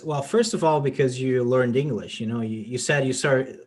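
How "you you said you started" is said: ordinary delivery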